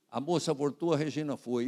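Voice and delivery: ordinary talk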